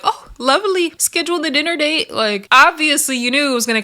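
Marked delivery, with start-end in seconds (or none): 0.0-2.1 much higher, mock-pleasant tone; sarcastic sweetness. Imagining Suki calmly accepting, sarcastic sweetness. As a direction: play it falsely delighted. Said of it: "Suki was gonna be like".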